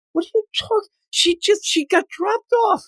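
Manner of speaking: high-pitched baffled protest; incredulous. Real, not acted. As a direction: object in exasperated disbelief. Host incredulous at the plot.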